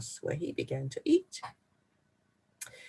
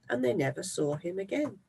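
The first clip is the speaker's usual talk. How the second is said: plain reading voice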